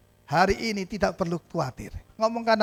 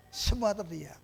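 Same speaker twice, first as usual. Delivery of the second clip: hushed repeat; 6 dB quieter, slower than usual. Shown as a demonstration. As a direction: drop "Semua terdiam" to a hush.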